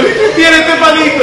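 high, loud, drawn-out pretend giggling; mock laughing. Shown as a demonstration. Teacher passes the palito on in the game riéndose, mock laughing. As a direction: play it as if laughing through the question. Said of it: "riéndose".

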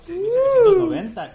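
high, loud shouted number; loud high blurt. Real, not acted. Blurting the follower count.

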